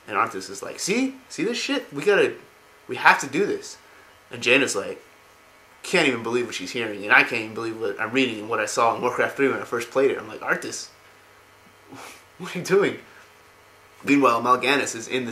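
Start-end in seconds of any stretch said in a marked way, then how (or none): 0.8-3.8 high-pitched mock insistence; retold vindication. Arthas feels backed up, insists. 10.4-13.0 higher, half-laughing disbelief; incredulous. Retold or imitated. Own incredulous reaction to the game.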